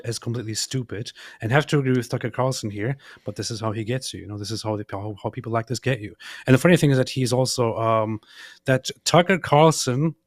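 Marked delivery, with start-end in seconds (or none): none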